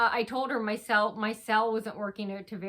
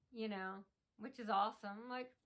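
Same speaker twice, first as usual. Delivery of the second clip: ordinary delivery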